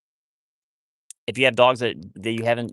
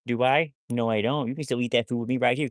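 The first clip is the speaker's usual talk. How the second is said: usual speaking voice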